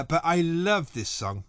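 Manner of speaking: usual speaking voice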